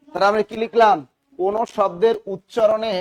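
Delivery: normal talking voice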